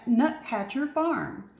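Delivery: ordinary delivery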